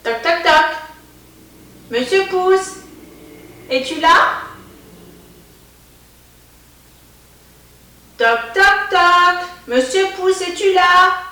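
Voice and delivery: high, loud sing-song call. Acted. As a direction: call out high to wake someone. Knocking to wake Monsieur Pouce.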